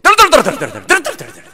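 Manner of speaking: high, loud mock shouting of a fiery preacher; imitating a preacher who shouts every sermon; mock fiery preaching. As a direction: rattle it off like a yelling preacher. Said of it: "इतने जोर जोर से चिल्ला chilli करके प्रवचन देते थे".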